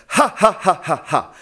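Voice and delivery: bouncy staccato "Ha" from the belly; diaphragm laugh exercise. Shown as a demonstration. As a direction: pulse each "Ha" off the diaphragm.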